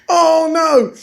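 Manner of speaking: high, helpless exclamation; mock dread. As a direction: act out seeing disaster coming. Acts own dread mid yank.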